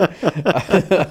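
amused little laugh. Real, not acted. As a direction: let a laugh out. Laughing at themselves.

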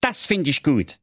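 high put-on old-man approval; Wilhelm calling out. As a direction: call out your approval like a cranky boss. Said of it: "rief der Wilhelm".

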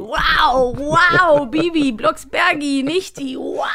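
mayor impression. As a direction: gush with overblown delight.

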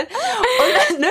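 high, slow, laughing through it. Real, not acted. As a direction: laugh the words out. Laughs at her own joke.

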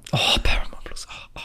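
breathy groan of disappointment; dismayed whisper. Real, not acted. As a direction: whisper it like bad news. Reacting to disliked streaming service.

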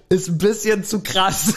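laughing. Real, not acted. Laughing at the over-the-top scene.

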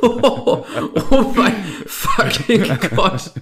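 slow, stretched, high through laughter; laughing in disbelief. Real, not acted. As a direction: gasp it out while cracking up. Reacting to dark joke. (lachend ungläubig)

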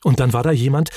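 ordinary delivery

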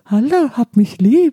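high, slow doll voice; talking doll. Retold or imitated. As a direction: speak like a creepy talking doll. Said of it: "wie eine Puppe sprechen würde".

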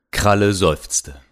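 plain reading voice

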